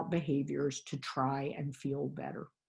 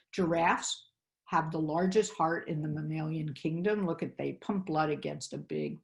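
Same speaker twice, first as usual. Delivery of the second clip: ordinary delivery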